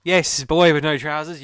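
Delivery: loud, slow call to the crowd. Acted. Addressing audience boy, a loud slow call. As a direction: call out across the room.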